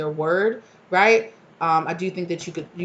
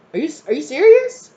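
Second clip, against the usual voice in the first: much higher and louder incredulous cry; outraged disbelief; 11 semitones higher, 11 dB louder than usual. Retold or imitated. Reader blamed by a coworker, loud outraged disbelief. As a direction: let the shock burst out loud.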